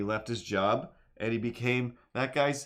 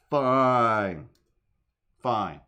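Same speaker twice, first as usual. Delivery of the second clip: loud exasperated fine; 7 dB louder than usual. Shown as a demonstration. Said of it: "repeated Fine takes".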